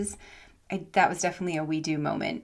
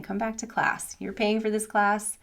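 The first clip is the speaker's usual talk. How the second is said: ordinary talk, nothing marked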